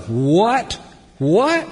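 high, loud stunned "What? What?"; shocked disbelief. Retold or imitated. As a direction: blurt it out, unable to believe it. Peter's shocked reaction acted out.

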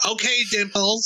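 slow, high, sing-song tease; high teasing coo; teasing girlfriend. Retold or imitated. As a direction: coo the pet name back at him.

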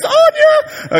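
very high, loud gloating cry; triumphant whoop. Retold or imitated. Elijah gloating as fire falls, high loud whoop. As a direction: crow it at the top of the voice.

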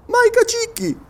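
very high, incredulous query; astonished question. Acted. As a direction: shoot the pitch right up in surprise. Surprised listener questions the sergeant.

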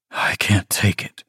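whispered, breaking inside; desperate whisper. A narrator's reading. Kaz alone at the sink, whispering in despair. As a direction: barely breathe the words out. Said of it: "Kaz thought, gulping for air".

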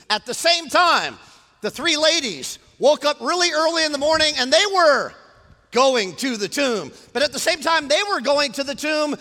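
high, loud, emphatic sermon voice; full-force preaching. Real, not acted. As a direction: proclaim it to the whole room.

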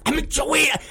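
high, strained and cut short; retold exasperation. Dad pleading with callers from the fabric store, exasperated. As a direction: protest high without much volume. Re-enacting his angry phone rant.

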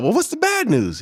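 retold himself bracing for bad news; anxious question. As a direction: player asking his agent on the phone, high and uneasy. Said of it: "And I said".

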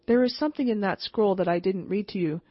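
ordinary delivery, nothing marked

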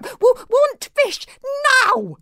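very high, slow creature stammer; high-pitched stuttering little dragon; demanding baby dragon. A narrator's reading. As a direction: demand it like a tiny imperious dragon.

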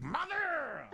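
mock scandalized high shriek; mock horrified shriek at mom; shocked cry. Scolding mom in retold scene.

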